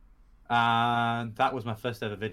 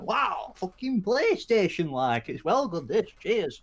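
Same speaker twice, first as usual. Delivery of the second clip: high, slow, amazed gushing; over-the-top kid excitement; 7 semitones higher, 4 dB louder, slower than usual. Retold or imitated. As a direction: gasp it out like a thrilled child. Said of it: "and you're like".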